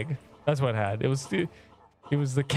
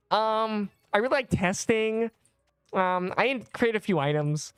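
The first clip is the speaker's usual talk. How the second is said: lightly voiced shy nerd, higher; hesitant, higher nerdy mumble; Noda impression; 5 semitones higher than usual. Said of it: "this is what Noda's gonna say".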